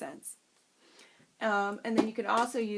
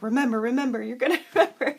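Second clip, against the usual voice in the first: louder, half-laughing imitation of panic; mock panic; 6 dB louder, faster than usual. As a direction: urge yourself on in comic panic. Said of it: "I panic and I start".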